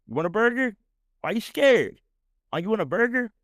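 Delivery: high, teasing mock voice; high hammed-up imitation of a pest; mocking taunt. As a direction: poke at him in a squeaky taunt. Mocking the burger guy again.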